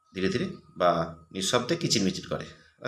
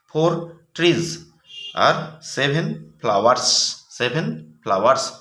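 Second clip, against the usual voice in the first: slow dictation; 2 semitones higher, 4 dB louder, slower than usual. Shown as a demonstration. Teacher dictating answers slowly.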